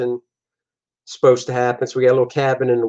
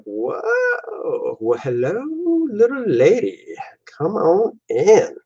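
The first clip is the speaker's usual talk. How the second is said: lightly voiced cheesy charmer, slow drawn-out come on in; hammy flirt welcoming the lady; smooth flirt; 6 semitones higher, slower than usual. Guy lets seductive lady in.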